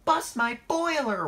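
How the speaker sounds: very high, loud wail; wailing, voice cracking. A narrator's reading. Percy shocked by the thought, wailing. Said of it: "wailed Percy as his voice cracked".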